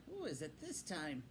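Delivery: a lightly voiced gruff Badger at the door; a hint of a gruff old badger asking who's there. A narrator's reading. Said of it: "asked a gruff voice".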